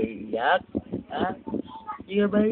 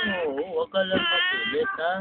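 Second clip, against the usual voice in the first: put-on baby talk; 12 semitones higher than usual. Parent coaxing baby.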